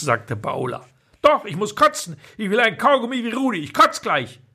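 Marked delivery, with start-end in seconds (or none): 1.2-4.4 put-on high, fast childish insisting; whiny child. Louis insists on gum to his mother, high and fast. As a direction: insist like a stubborn little boy.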